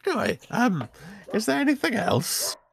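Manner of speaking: very high-pitched wizard voice; impatient wizard. Acted. Wizard shopkeeper to customer, impatient to finish. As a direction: move the customer along in a high voice.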